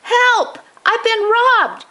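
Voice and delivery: put-on high old man's panicked cry; Grandpa shouting. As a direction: cry out like a grandpa who has lost something precious. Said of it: "We heard Grandpa shouting".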